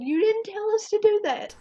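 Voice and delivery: high, loud furious retort; mother shouting at the dispatcher, loud and high; angry scream. Retold or imitated. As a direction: yell it back at her in outrage. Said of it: "I screamed at her".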